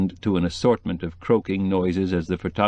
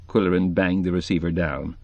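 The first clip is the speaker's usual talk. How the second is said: plain reading voice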